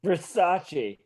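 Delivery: "Versace" said as ver-SAH-chee; correctly pronounced Versace. Acted. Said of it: "He pronounces it correctly".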